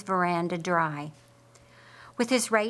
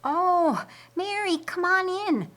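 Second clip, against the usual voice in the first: lifted, bright-pitched woman's greeting; high female voice; 11 semitones higher, 3 dB louder than usual. A narrator's reading. Mary greeting Esther at her door, high voice. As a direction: voice the women higher at the door.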